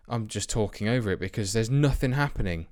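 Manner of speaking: ordinary talk, nothing marked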